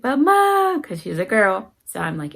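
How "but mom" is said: high, loud mock wail; crying child. Crying daughter complains to her mom, high mock wail. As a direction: imitate a little girl crying to her mom. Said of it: "she's crying and she's like".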